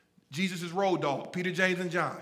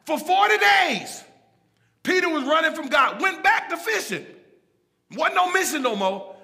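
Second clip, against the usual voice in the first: loud, driving pulpit delivery; full-force preaching; 6 semitones higher, 9 dB louder than usual. Real, not acted. Preacher driving home grace to his congregation, full-force preaching. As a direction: preach it hard, punching key words.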